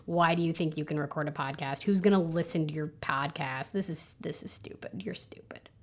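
low, quiet grumbling put-down; gremlin inner voice. Acted. Inner gremlin belittling a new podcaster, low muttered scorn. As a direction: mutter scorn under your breath, pitch dropped. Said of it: "we all have those little gremlin voices".

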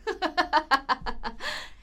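a short, amused, menacing laugh from the captor, rising at the end; captor's laugh. Acted. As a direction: laugh down at the terrified kid.

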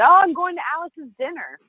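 high, singsong casual answer; airy breezy reply. Retold or imitated. Telling her mentor about the dinner, airy and high. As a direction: lift it high as if no big deal.

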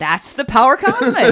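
high, loud, laughing boast; triumphant catchphrase. Acted. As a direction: proclaim it with hammy glee. Playful punchline of repeated catchphrase.

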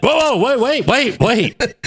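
high, quick string of interruptions; urgent stopping. Retold or imitated. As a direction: cut in high to halt someone mid-move. Acting out man stopping the cut.

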